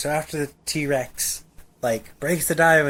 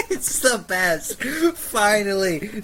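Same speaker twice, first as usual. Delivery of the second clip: laughing delight; 6 semitones higher, much slower than usual. Real, not acted. Delighted at Sheen's meat shield.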